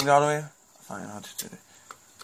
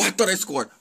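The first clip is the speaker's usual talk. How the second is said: normal talking voice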